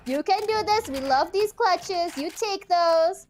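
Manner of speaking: high, loud hopeful cheer; root for her out loud; excited cheering. Real, not acted. Cheering on a clutch.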